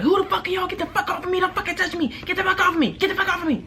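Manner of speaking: high, rapid-fire mimicry of an angry woman; imitated tirade, very high and hurried; fast-talking rant. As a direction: rattle it off in a squealed, frantic voice. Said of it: "Talking fast as shit".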